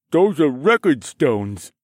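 mumbling through a mouthful of cake; mouth full. Acted. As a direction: talk around a bite of cake. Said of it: "Beryl mumbled through a mouthful of cake".

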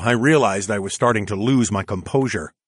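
usual speaking voice

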